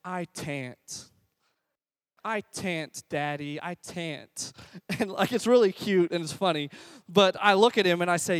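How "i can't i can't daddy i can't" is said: put-on small, soft little-boy voice; toddler son. Toddler tells his dad he can't, soft pitiful little voice. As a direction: imitate a toddler quietly giving up. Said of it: "he'll just turn around and say".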